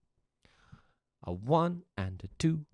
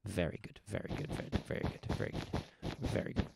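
softer, breathy voiceless very good; whispered praise; 5 semitones lower, 10 dB quieter than usual. Real, not acted.

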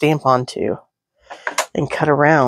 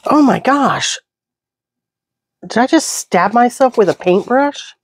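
shocked; 7 semitones higher than usual. Real, not acted. Reacting to stabbing herself.

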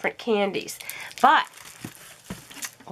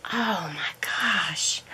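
slow, soft whisper of wonder; hushed slow whisper; awed whisper; 6 semitones lower, 6 dB quieter, slower than usual. Real, not acted. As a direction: breathe it in amazement. Awed by gift.

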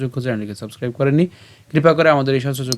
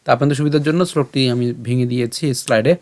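normal talking voice

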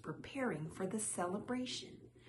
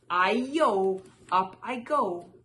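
loud, rollicking getaway cry; 8 dB louder, slower than usual. A narrator's reading. Rice cake spins up a steep street, crying out. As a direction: call out loudly on the run.

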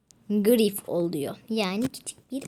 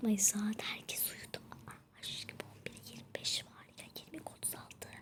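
hushed whisper so dad stays asleep; late-night whisper into the mic; 5 dB quieter than usual. Real, not acted. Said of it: "böyle konuşmak için böyle konuşuyorum".

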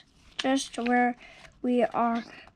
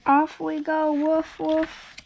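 higher, bright "off we go" cheer; excited; 4 semitones higher than usual. Acted. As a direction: sound thrilled at the sled start. Sledding scene, excited.

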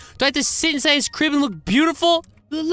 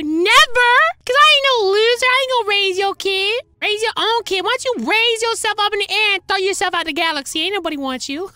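sassy shout; 7 semitones higher than usual. Acted. Woman rejects loser.

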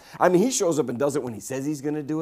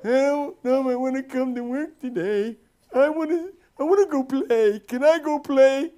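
put-on high, whining grown man like a toddler; mocking a lazy worker as a whiny baby; 10 semitones higher than usual. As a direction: whine it like a big baby. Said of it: "Forty-year-old babies".

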